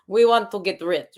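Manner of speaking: put-on French accent. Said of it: "should I use a French accent?".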